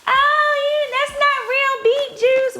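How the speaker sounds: high mocking voice of commenters. Quoting commenters who joke at her, high mock voice. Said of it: "people be trying to joke me".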